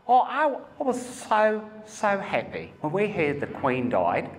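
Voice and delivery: lightly voiced excited lady; a mild retold shout of joy; quoting the first lady. Comedian quotes a delighted audience member, mildly excited. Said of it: "she shouted out".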